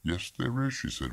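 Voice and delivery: high, soft contradiction; insistent wife. A narrator's reading. Wife contradicting her evasive husband, softly insistent.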